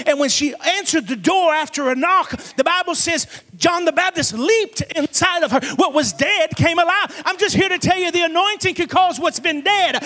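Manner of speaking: full-force preaching. Real, not acted. Preacher telling the church the anointing still works, preaching hard. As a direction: drive the story toward each emphatic payoff.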